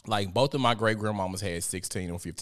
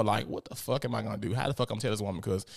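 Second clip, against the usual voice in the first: usual speaking voice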